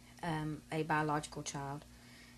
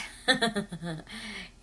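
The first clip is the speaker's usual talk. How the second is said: real chuckle at her own bad luck; higher-pitched run of chuckles; rueful laugh; 4 semitones higher than usual. Real, not acted. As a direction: laugh it off after the letdown. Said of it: "I'm laughing now".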